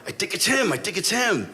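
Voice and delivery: mildly frantic, urgent report to a partner; retold excited realization; flipping out.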